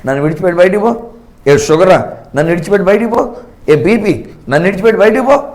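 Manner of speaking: loud, forceful command driving the sickness out; a preacher rebuking illness, loud and commanding; commanding rebuke. Shown as a demonstration. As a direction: order the disease to leave with full authority. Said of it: "ఇప్పుడు నేను మాట్లాడుతాను".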